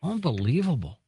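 a high, hushed gasp of disbelief; stunned disbelief. Real, not acted. Host reacting to the veterans' deportation news, stunned. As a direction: let it sink in, shocked.

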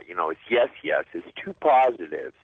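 ordinary talk

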